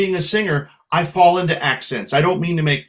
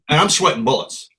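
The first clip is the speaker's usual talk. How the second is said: usual speaking voice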